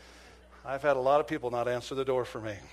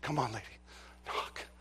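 urging softly; 3 semitones lower, 7 dB quieter than usual. Retold or imitated. Silently urging her to knock.

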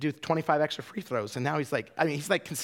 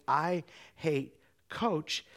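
very slow, word by word; halting, with a gap before the last word; ingrained mantra; much slower than usual. Retold or imitated. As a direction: stretch each word out, suspended. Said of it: "so ingrained to say".

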